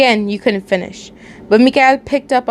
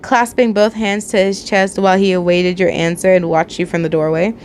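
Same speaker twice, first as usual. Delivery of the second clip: ordinary delivery, nothing marked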